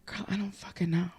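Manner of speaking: hushed whispered aside. Real, not acted. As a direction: drop to a whisper, half laughing.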